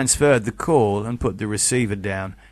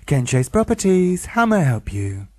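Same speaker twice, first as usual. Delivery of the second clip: perky phone greeting; bright and cheerful; 5 semitones higher than usual. Acted. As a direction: sing out the company name brightly. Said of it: "bright and cheerful manner".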